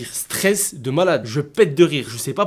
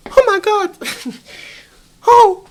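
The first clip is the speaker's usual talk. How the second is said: very high, loud alarmed voice; shocked receptionist; 9 dB louder, slower than usual. Retold or imitated. Hotel receptionist seeing the bloody guest, shocked. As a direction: gasp in horror at the blood.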